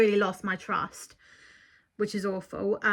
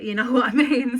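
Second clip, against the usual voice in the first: real laughing; 4 dB louder than usual. Real, not acted. Unsure why she is laughing after swearing about the trauma, laughing. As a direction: talk through a laugh. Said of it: "I don't know why I'm laughing".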